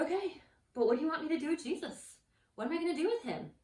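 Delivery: loud, high-pitched questioning; exasperated shock. Acted. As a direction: act out a baffled Pilate. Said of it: "Pilate was shocked".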